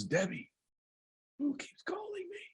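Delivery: high, faint, airless whisper; dying father's whisper. Retold or imitated. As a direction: gasp it out with no breath. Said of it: "my dad sounded more like this".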